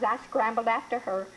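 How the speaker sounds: usual speaking voice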